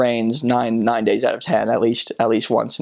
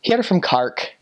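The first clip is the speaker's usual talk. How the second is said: put-on higher, lilting Cork accent; Cork Irish accent; 6 semitones higher than usual. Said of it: "they all called it Cark".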